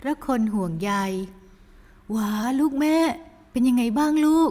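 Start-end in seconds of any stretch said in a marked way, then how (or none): 1.5-4.5 higher, slow anxious mother's voice; worried mother. Acted. Mother rushing to her injured daughter, slow and anxious. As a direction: fret over a hurt daughter, slowly.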